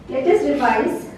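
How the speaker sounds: ordinary talk, nothing marked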